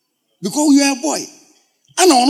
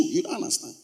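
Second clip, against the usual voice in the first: normal talking voice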